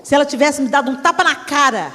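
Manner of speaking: normal talking voice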